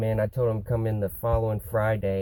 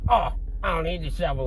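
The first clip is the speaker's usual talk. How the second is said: higher-pitched mocking mimicry; 5 semitones higher, faster than usual. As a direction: parrot it back mockingly. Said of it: "like, mocking me and shit".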